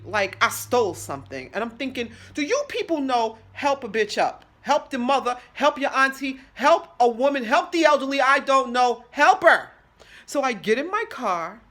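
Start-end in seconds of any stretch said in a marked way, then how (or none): 2.3-9.7 loud, high, building rant; exasperated scolding. Acted. Injured woman to staring onlookers, loud exasperated scolding. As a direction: scold the onlookers, louder with each plea.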